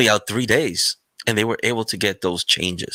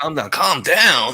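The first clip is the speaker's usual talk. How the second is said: drawn-out, raised-pitch mock command; mock calm-down order; 4 semitones higher, slower than usual. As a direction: imitate someone pushily ordering calm, slowly. Mimics someone telling others to calm down.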